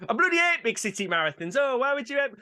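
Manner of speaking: high mock-Yorkshire moan; northern English accent. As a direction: grumble in a put-on northern accent. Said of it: "I do a northern accent".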